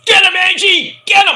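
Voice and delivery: imitated frenzied commentator yell; high, loud mock urging her on; Michael Cole. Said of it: "Cole's like".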